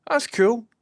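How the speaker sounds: very high, squeaky reply; mock-squeaky answer to the stranger; retold squeaky cool. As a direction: squeak it out, caught off guard. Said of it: "And I was like".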